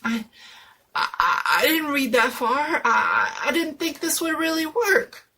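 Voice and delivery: high, loud stuttering panic; panicked stammer. Acted. Jones stammering to friends about the spell, panicked. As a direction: stumble over the words, scared.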